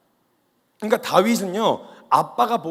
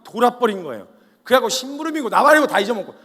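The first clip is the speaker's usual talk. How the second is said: louder, higher, gathering heat; fired-up preaching; 5 semitones higher, 8 dB louder than usual. Real, not acted. Preacher describing David snapping, building excitement. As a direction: preach it with rising fire.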